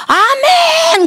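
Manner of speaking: very high ecstatic shout of amen. Retold or imitated. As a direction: cry out from the back of the hall. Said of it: "그러는 거예요".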